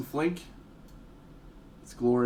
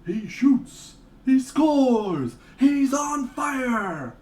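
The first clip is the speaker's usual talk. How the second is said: louder and higher, building to a drawn-out shout; mock commentator; 11 semitones higher, 6 dB louder, much slower than usual. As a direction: hype it like a sportscaster calling a goal.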